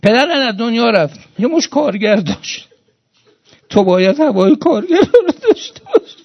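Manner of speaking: high, loud pulpit exhortation; fiery preaching. Acted. Preacher exhorts mourners to honour their father's workers, fiery preaching. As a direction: drive it home to the congregation with full force.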